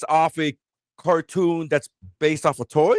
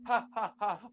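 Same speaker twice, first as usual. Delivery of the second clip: mock laughter; 4 semitones higher, 13 dB quieter than usual. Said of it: "standing around laughing".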